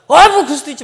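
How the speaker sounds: loud, high irritated outburst; acted flare-up, blurted defensively; snapping in temper. Someone who erred lashing out instead of apologising, angry. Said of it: "막 승질내는 사람이에요".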